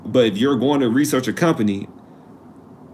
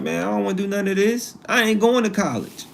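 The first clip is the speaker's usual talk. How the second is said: put-on higher-pitched, balking kid's protest; dismissive student; 8 semitones higher than usual. Student turned off after looking at the school online, dismissive refusal. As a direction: play a kid refusing, pitched up. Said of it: "they like".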